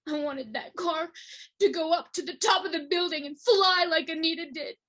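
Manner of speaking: tearful shouting, climbing to a high scream; anguished rage. Acted. Speaker wanting revenge on Anita's attacker, anguished rage. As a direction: cry it out loud, building to full-voiced fury.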